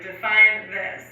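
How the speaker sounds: drawn-out deadpan question; slow deadpan. Retold or imitated. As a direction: stretch each word slowly. Mimicking listener's slow "define.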